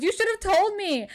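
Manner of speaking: lightly scold, voice lifted high; mock indignant. Woman scolding man over the misplaced phone, mock indignant.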